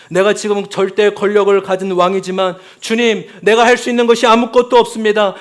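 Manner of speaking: loud anguished confession; desperate royal prayer. Acted. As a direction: beg from helplessness, raising the voice. King's desperate prayer voiced.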